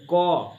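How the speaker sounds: क pushed from the throat with a clear stop; showing where क is made; throat sound demo. Shown as a demonstration. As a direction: say क hard from the back of the throat. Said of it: "देखो यहाँ पे जोर पड़ेगा ना".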